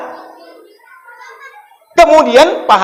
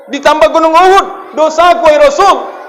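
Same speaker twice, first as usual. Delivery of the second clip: raised, higher pleading voice full of guilt; anguished plea; 6 semitones higher, 3 dB louder than usual. Acted. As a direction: cry out your sin to the Prophet. Young man's anguished confession to Rasul. (pemuda memohon dengan suara tinggi dan penuh sesal)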